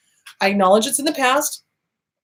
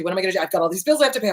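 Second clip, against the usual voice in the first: ordinary delivery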